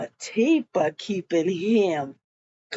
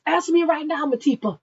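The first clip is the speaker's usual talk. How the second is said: higher-pitched mock demand; insistent acted demand; 6 semitones higher, 4 dB louder, much faster than usual. Man confronting Matipa for an answer, insistent demand. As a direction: press for an answer in a retold quarrel. He repeats his demand, quarrelling.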